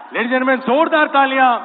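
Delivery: loud, high-pitched emcee shout; announcer's applause call. Real, not acted. Host hails the famous Sharma to the crowd, shouting like an emcee.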